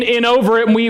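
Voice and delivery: ordinary talk, nothing marked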